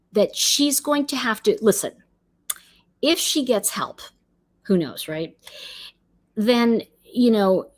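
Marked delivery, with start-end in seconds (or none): none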